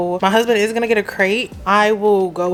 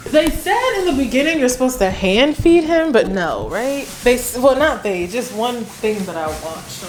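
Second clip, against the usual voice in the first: ordinary delivery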